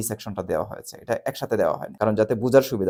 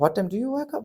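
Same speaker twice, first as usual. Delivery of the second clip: friend asking; 11 semitones higher, much slower than usual. Retold or imitated. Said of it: "your friend বলছে".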